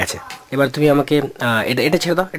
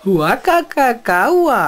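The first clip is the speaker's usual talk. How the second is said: crow imitation; 6 semitones higher, 3 dB louder, much slower than usual. Shown as a demonstration.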